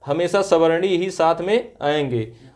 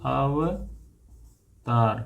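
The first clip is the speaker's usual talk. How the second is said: very slow, each syllable stretched apart; drawn-out dictation; 5 semitones lower, 6 dB quieter, much slower than usual. Shown as a demonstration. Teacher spelling out Avtar for students, drawn out slowly. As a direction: stretch the word out for the class: Avtar as a-va-taar, slowly. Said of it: "teacher dictates the word syllable by syllable".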